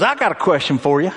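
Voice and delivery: ordinary talk, nothing marked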